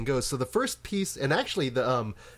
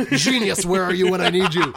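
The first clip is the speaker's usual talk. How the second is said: loud, laughing mock cry; laughing plea; 5 semitones higher, 8 dB louder than usual. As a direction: call out for help, laughing. Retold cry for co-host, laughing.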